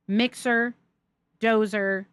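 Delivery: ordinary delivery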